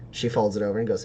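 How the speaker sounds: usual speaking voice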